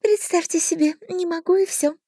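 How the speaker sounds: high, petulant fairy voice; a fairy spreading her hands; pixie. A narrator's reading. Fairy shrugs.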